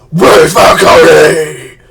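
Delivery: slow, hugely loud mock Batman rasp-shout; Batman growl shout. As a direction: ham up Bale's Batman yelling the question. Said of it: "Doesn't have to be like".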